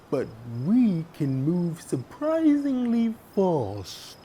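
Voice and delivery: slow, high, loud offended protest; indignant turtle, slow and loud; quite upset. Acted. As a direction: bristle at the insult. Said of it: "getting quite upset".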